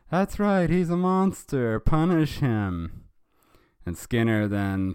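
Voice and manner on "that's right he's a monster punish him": high, loud mob cry; angry crowd voice. Acted. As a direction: call for punishment in a put-on high voice. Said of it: "I don't know whose voice to do it".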